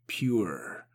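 plain reading voice

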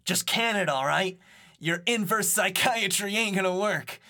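put-on high, brash street kid; lightly voiced cocky teen; cocky Beat voice. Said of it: "Beat says".

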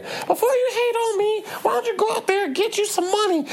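very high mocking little-girl voice; squeaky bratty kid bragging, imitated; high mock child voice flexing her clothes. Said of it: "She's like".

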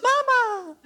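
high, loud call with a laugh; yelled greeting. Retold or imitated. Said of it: "he goes".

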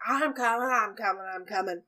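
calling out, fading as he nears; grumbling old man hurrying to the door; mild mock shout from behind a door; shouting back from inside. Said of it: "you hear shouting from the other side".

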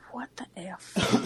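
unvoiced, stunned what the F; whispered disbelief. Real, not acted. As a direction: breathe it out in shock. Shocked aside.

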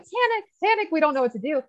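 high, mock-frantic doctor voice; mocking panicked doctors. Guest mocking other doctors, high and frantic. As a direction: ham up the clueless doctors' panic. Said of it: "every other doctor's like".

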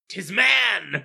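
loud, high exclamation; mock-dramatic shout; mock dramatic discovery. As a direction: announce it as a hammed-up horrified wine taster. Acted wine drinker discovering the body.

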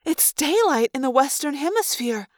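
high-pitched, puzzled observation; confused. A narrator's reading. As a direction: work it out aloud in bewilderment. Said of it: "sounding more confused than terrified".

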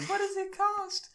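put-on high, slow baby voice. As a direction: squeak it slowly like a baby. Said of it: "I was like".

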